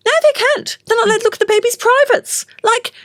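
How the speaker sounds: high, exasperated outburst. Real, not acted. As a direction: let the outrage lift your voice. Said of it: "indignant about new rules".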